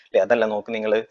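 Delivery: ordinary talk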